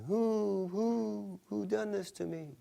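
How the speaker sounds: high hooting owl voice. Acted. Owl flying at night asking who did this, high hoot.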